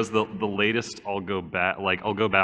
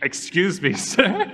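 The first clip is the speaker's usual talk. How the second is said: laughing mock apology; 8 semitones higher, slower than usual. Mock talking to police officer.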